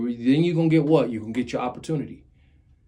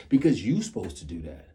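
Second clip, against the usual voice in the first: ordinary talk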